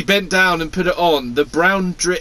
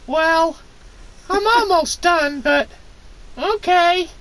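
high whiny voice; slower than usual. Acted. [name]'s high voice.

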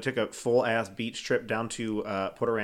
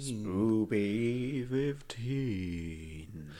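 drawn out; 6 dB quieter, much slower than usual. Acted. Echoing promo code slowly.

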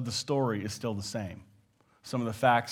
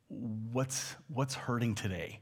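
softer, lower tender ask; gentle caring question; 3 semitones lower, 8 dB quieter, slower than usual. Retold or imitated. Preacher showing a tender question to a hurting friend. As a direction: ask softly what hurts.

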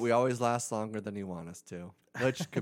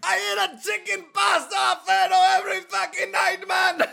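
shouting Italian mobster, much higher; Italian mafioso accent; 10 dB louder than usual. Pretend. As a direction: yell it like a mob guy. Italian mafioso bit continues.

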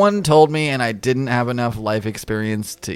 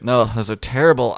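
ordinary delivery

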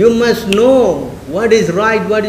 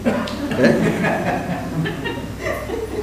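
breathy laugh; 3 semitones higher, 9 dB quieter than usual. Real, not acted. Laughs at train station story.

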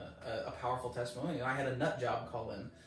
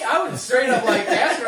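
usual speaking voice

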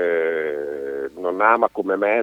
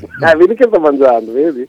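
loud, high mock stoned voice; hammed-up stoned guy with the munchies; 7 semitones higher, 11 dB louder than usual. Joking friend is stoned eating.

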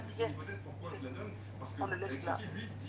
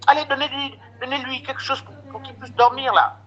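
raised, bossy scolding, higher and louder; scolding the husband; 6 semitones higher, 4 dB louder than usual. Retold or imitated. Sister-in-law scolds husband into sedating him, raised scolding. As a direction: bark orders at the staff to sedate him. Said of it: "elle engueule le mari".

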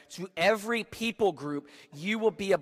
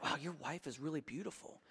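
hushed Egyptian admirer; 3 semitones lower, 14 dB quieter than usual. Retold or imitated. Egyptians voiced.

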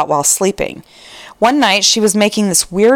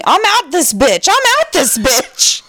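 higher-pitched mock yell, no real volume; 6 semitones higher than usual. Husband fleeing an imagined giant spider, mock yelling. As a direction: imitate a panicked sleeper's yell, rising on the repeat. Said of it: "run out of the bedroom yelling".

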